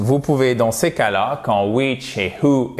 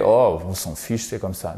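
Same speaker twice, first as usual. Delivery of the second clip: couldn't-care-less; 5 semitones lower than usual. Acted. Teacher acting out learners who give up, mock indifference. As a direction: shrug it off, dropping away. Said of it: "pas tomber dans le côté".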